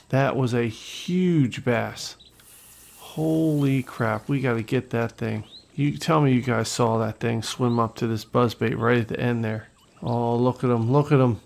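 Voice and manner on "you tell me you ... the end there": ordinary talk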